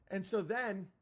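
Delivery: ordinary talk, nothing marked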